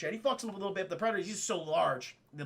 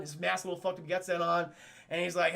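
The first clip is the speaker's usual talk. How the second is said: normal talking voice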